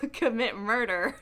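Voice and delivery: normal talking voice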